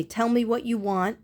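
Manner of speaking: normal talking voice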